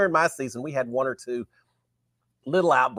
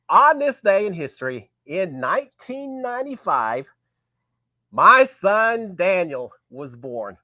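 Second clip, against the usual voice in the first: slow proud announcement; 3 semitones higher, 5 dB louder, slower than usual. Real, not acted.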